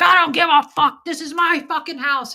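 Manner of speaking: imitated angry shout, high and very loud; intimidating rant. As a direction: shout it like someone getting in a face. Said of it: "He'd try to get really intimidating".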